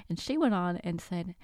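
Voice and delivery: ordinary talk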